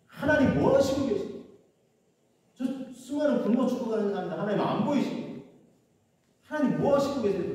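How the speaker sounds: high, pleading reproach to God; scolding God. Retold or imitated. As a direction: demand an answer from God, pitch up. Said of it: "하나님을 꾸짖듯이".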